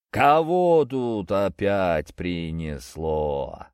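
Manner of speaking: slow booming giant voice; troll bass. Acted. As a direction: drag it out like a huge troll. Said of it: "раздался бас". (гулкий бас тролля)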